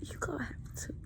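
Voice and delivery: soft whisper, pleading; earnest whispered urging; whispered plea. Real, not acted. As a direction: lean in and whisper it. Urging abused women to leave.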